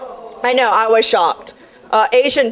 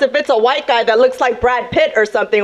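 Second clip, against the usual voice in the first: ordinary delivery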